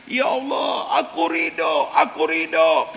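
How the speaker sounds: high, loud, tearful cry of surrender; a mother's sobbing prayer, pitched high and loud. Acted. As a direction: cry it up to God, voice breaking.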